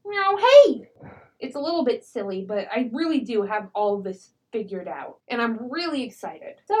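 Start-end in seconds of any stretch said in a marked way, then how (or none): 0.1-0.9 high, loud meow then hey; crow-woman names a catfolk by mimicry; mimicked made-up word. Shown as a demonstration. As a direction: squawk the two copied sounds together. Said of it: "So she would call it".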